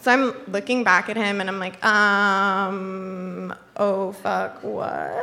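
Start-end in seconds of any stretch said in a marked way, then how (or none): 1.8-5.2 long hesitant um, then soft low query; wary questioning. Retold or imitated. Looking back at her date to ask what's wrong, drawn-out wary doubt. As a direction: stretch the um, then ask warily.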